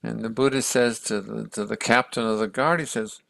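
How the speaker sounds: usual speaking voice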